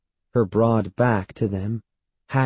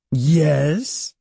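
raised, pouncing question; higher, mildly bellowed with a rise; bellowing; 6 semitones higher, 4 dB louder than usual. A narrator's reading. Great-aunt crying out in the boy's ear, mild bellow. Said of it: "bellowing in his ear".

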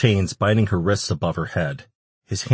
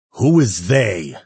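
slow, loud growling question; growled demand; 3 semitones higher, 6 dB louder, slower than usual. A narrator's reading. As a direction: growl it at a captive. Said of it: "Ash growled".

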